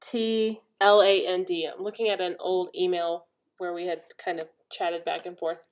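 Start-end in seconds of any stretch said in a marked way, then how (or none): 0.0-1.7 slow, letter by letter; spelling it out. Shown as a demonstration. Spelling the wool's name for viewers, slow and clear. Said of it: "J A M".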